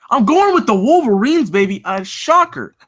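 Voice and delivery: high, loud, hyped-up proclamation; excited announcement. Real, not acted. Host proclaiming a shocker pick to viewers, excited. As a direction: belt out the pick like a big reveal.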